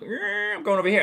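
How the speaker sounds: high-pitched voice pulling away; acted-out wandering impulse. Acts out his drifting impulse.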